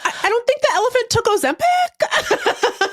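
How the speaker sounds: high-pitched, incredulous laughter; squeaky-high, laughing through the words; laughing disbelief. Real, not acted. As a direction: crack up while protesting the absurdity. Incredulous mockery of commenters, cracking up.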